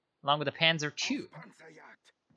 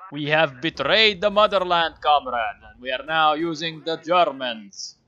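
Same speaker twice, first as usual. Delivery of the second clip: loud, booming Soviet officer; Soviet Commissar voice; 3 semitones higher, 6 dB louder than usual. Pretend. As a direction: thunder it like a political commissar. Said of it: "glorious Soviet Commissar voice".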